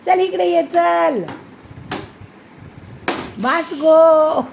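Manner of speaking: loud call to come here, last word drawn out slowly. Real, not acted. Mother calling child over.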